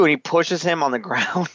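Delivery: ordinary delivery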